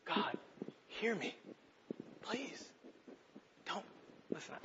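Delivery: soft, broken prayer with long pauses; hushed plea. Acted. Pastor showing how he really prays, a hushed plea. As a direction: beg God quietly, barely voiced. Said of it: "this is how I do it".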